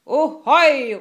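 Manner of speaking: high, loud drawn-out hail; pirate shout. A narrator's reading. Pirate Killer answers the captain, shouting. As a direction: holler it across the deck. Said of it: "råbte Killer".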